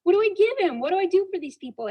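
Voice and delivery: high, fast, bewildered cry upward; flustered plea, pitched high and rushed; exasperated plea to God. Retold or imitated. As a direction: throw up your hands and beg heaven for answers. Raising my hands to God.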